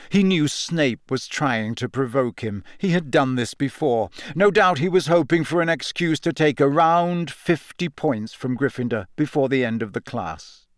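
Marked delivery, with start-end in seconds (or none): none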